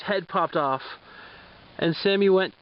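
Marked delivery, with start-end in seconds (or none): none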